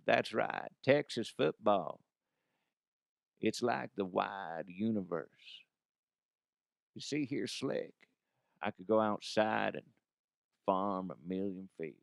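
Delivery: lazy Texan musing, half-hearted; McConaughey impression. Shown as a demonstration. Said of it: "See, that's not that good".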